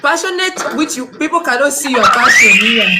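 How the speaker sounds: host scolding a candidate with only passion, loud; exasperated shout. Real, not acted. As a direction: throw the word back loud and high. Host mocking passion claim.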